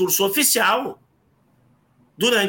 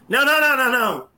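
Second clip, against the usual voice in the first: mock shout; faster than usual. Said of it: "Aí ele pegou e falou assim, em público". (o menino gritando em público que a brincadeira acabou, em tom de grito fingido)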